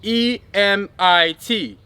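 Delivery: E M I T spelled letter by letter, slowly. Shown as a demonstration. Teacher spells E M I T for listeners, slowly. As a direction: spell out E M I T one letter at a time. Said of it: "just spell it out with me".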